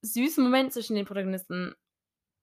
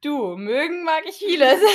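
high, loud and drawn out with laughter; slow mock reply, breaking into laughter; laughing retold quip; 8 semitones higher, 8 dB louder, slower than usual. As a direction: tease with a laughing quote. Teasing retold exchange, laughing.

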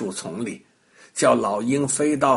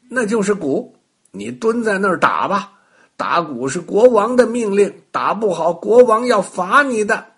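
a hint of an official rabbit, higher; bossy bunny; 6 semitones higher, 5 dB louder than usual. A narrator's reading. Rabbit orders the witch to drum, lightly voiced. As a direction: lay down the king's order.

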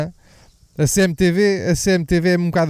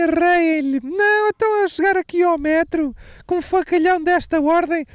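much higher, far put-on old woman's voice; old lady impression; 12 semitones higher than usual. Old woman in the metro urging people to beat him, high cranky voice. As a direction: play a fired-up old lady crying for punishment. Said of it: "uma velha".

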